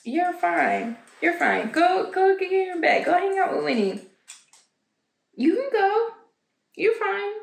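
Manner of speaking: high sing-song talk to the cat; high pet-talk telling the cat to go to bed; coaxing pet voice. Real, not acted.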